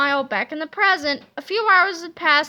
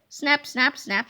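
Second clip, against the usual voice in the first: ordinary delivery, nothing marked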